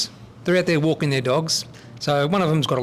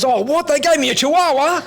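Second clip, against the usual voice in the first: higher, louder punchline of outraged disbelief; indignant surprise; 9 semitones higher, 4 dB louder than usual. Acted. Dog owner bluffing the bartender as a blind man, astonished. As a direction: exclaim it in mock astonishment, pitch jumping up.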